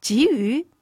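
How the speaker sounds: mildly startled questioning; taken aback. Acted. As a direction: repeat it haltingly, as if caught off guard. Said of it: "就愣了一下".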